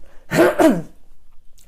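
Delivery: loud rough clear of a sore throat. Real, not acted. Streamer with a sore throat clears her throat loudly. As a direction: clear the throat hard, then carry on. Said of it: "still got a bit of a sore throat".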